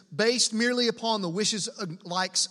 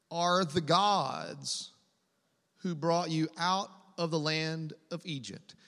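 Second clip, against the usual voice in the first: slow proclamation; 3 semitones lower, slower than usual. Acted. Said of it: "They exclaimed".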